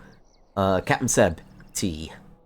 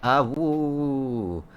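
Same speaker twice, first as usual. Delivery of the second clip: wolf howl; 5 semitones higher than usual. Retold or imitated. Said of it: "That's the sound he goes".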